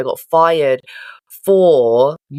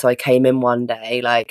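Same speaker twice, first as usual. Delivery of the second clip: ordinary delivery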